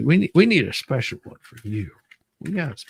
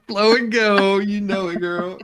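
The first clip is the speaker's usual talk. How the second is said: sassy hype; 5 semitones higher, 5 dB louder, slower than usual. Acted.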